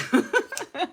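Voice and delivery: high, loud short laugh; amused laugh. Real, not acted. Streamer forgetting today's date, high amused laugh. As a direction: crack up at your own forgetfulness.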